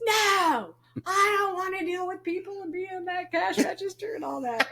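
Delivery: put-on high, whining young man; whiny son. Mom voicing her son whining about the cash register. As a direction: complain like a sulky teen.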